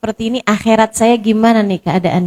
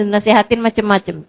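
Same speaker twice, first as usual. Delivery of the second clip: ordinary delivery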